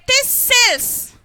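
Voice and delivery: slow "thistles" with a long S, thisss-sils; stressed S, silent T. Shown as a demonstration. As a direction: draw out the S in "thistles", leaving out the T. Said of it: "I gave stress for S".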